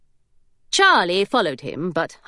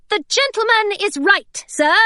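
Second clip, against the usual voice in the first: a bit too loud and eager, pitched high; too loudly; 12 semitones higher than usual. Acted. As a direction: overplay the politeness, a touch too loud. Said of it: "too loudly perhaps".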